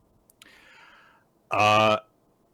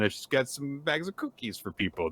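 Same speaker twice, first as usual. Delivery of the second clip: normal talking voice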